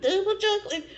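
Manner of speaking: "double chocolate" squeaked high with a laugh; playful high ant voice; partying ant. Pretend. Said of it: "act out an ant having a donut party".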